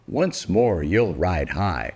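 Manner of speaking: plain reading voice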